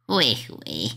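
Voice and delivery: low sneering snicker; mocking villain snicker. Villain mocks the timid explorer with a low snicker. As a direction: snicker down at the scared hero.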